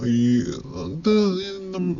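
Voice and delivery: ordinary talk, nothing marked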